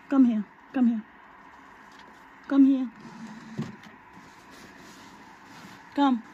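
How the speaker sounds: loud, high call across the yard; calling the escaped bird back, loudly. Real, not acted.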